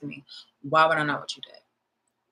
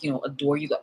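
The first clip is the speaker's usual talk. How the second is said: normal talking voice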